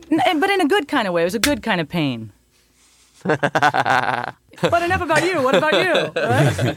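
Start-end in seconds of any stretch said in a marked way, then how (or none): 3.2-6.1 sarcastic. Pretend. Ironic joke, laughing.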